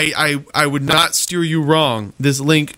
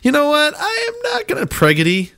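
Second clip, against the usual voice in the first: much higher, squeaky mock voice; mock inner voice; 10 semitones higher than usual. Retelling his own thought in a squeaky high voice. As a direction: pitch it way up like a silly inner voice. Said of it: "And I was just like".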